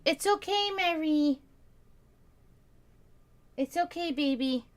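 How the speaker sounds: high sing-song cooing. Real, not acted. Owner soothing her cat from across the room, high coo. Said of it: "That's the only way I can talk to my cats".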